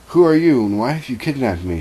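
sudden high, loud outburst, then settling; indignant demand. A narrator's reading. Kidnapped woman confronting her mysterious captor, indignant demand. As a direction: read the captive's indignant challenge.

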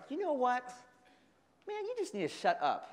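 a playful, half-hearted shut up; mock snap. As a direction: snap back, losing patience. Snapping at friend.

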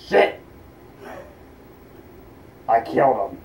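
usual speaking voice

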